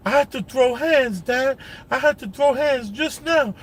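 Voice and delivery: put-on high insistent kid voice; imitating his son insisting he had to fight; son's voice. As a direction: pitch way up as the boy repeating his news. Father voicing young son's words.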